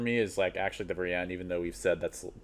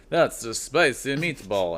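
slow, higher-pitched mock Italian accent; imitated Italian accent, drawn out and raised; hammy Italian accent; 5 semitones higher, 4 dB louder, slower than usual. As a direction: put on a big movie-cartoon Italian accent. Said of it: "gets the Italian accent going".

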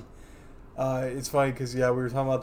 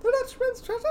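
high squeaky cartoon voice. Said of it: "both quote".

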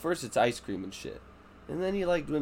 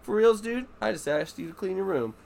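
high, disbelieving for reals; incredulous; 8 semitones higher than usual. Retold or imitated. Dad reacting to daughter's eye roll, disbelieving. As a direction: scold with mock disbelief. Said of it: "and I'm like".

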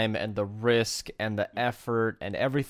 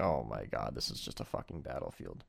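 stunned aside; 13 dB quieter than usual. Retold or imitated. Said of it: "I'm like".

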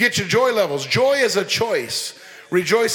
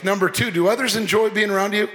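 ordinary delivery

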